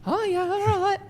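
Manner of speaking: very high, squeaky self-parody. Shown as a demonstration. Host mocking his own accent to his mate, squeaky. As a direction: squeak it out like a cartoon of yourself. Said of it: "mine's a little bit more squeaky".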